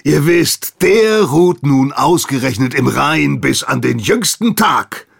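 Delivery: loud, high sarcastic sigh; mock politeness. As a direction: feign regret with contempt. Hagen's mock deference.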